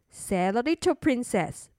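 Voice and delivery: plain reading voice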